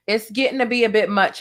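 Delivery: ordinary talk, nothing marked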